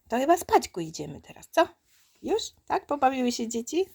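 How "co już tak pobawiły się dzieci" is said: normal talking voice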